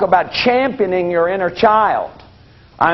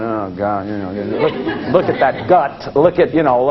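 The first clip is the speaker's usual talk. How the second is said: disgusted self-critic; 7 semitones lower than usual. Retold or imitated. Workshop people seeing themselves walk in, low mock groan. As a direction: grumble at your own reflection. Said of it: "people start going".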